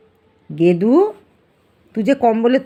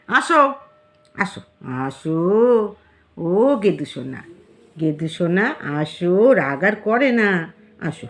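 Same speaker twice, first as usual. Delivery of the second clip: low, slow cooing to a pet; tender coaxing; 4 semitones lower, slower than usual. Real, not acted. Owner calling a sulking cat to come, tenderly coaxing. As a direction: coax gently, drawing out the endearment.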